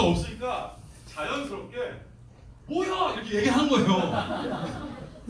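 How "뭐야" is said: surprised. Retold or imitated. Confused at dead mic.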